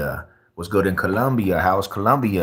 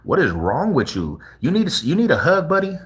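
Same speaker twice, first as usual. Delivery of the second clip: much higher, fast, coddling sing-song; mock baby talk; 9 semitones higher than usual. Streamer mocking an angry dude, high coddling baby voice. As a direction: talk down to him like a pouting toddler. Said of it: "I'm like".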